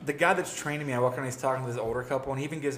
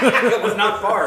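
laughing; 6 semitones higher, 6 dB louder, slower than usual. Real, not acted. Laughing at the silly purchase.

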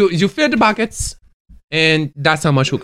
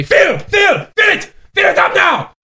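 mock shout; 12 semitones higher than usual. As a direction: yell the order across the river. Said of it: "you take and you say".

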